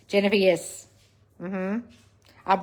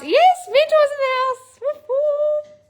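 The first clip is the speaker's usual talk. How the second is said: high, slow, drawn-out celebratory cheer, much higher and louder; 6 dB louder, slower than usual. Real, not acted. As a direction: hype the room up like a party host. Cheering the mentors in chat.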